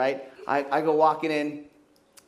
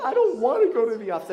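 put-on worried kid; 6 semitones higher, 5 dB louder than usual. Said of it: "And she's like".

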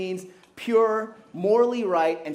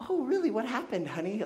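much higher, gentle fatherly question; sweet dad voice; 6 semitones higher, 4 dB quieter than usual. Retold or imitated. Dad calmly asking his daughter what happened, higher. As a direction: coax it out of your little girl. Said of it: "And I was like".

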